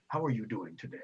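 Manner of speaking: a lightly voiced gentle elder; benevolent priest. Priest figure voice.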